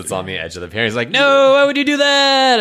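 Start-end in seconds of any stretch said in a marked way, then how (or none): 1.1-2.6 mock wail. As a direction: wail it like a toppling villain. Machine falling into the water.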